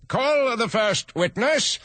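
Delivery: high, slow royal order; pompous king calls out. Acted. King voiced in read story.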